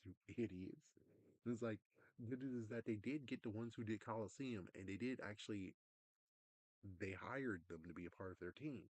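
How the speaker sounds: ordinary delivery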